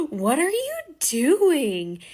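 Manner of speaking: high, loud, drawn-out horrified question; slow, stretched mock horror; shocked outburst. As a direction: act scandalized by what you see. Friend shocked at toilet paper.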